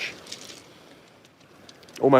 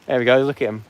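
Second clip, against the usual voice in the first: ordinary delivery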